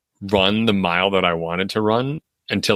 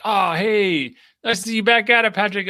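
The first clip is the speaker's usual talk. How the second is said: much higher, bright cheery greeting; cheery passerby; 12 semitones higher than usual. Retold or imitated. Runner imitating a passerby's cheery greeting, much higher. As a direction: greet like a peppy neighbour on the sidewalk.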